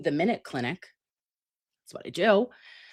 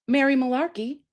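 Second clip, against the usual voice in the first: normal talking voice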